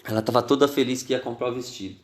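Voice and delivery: usual speaking voice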